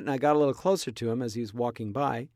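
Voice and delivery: ordinary talk, nothing marked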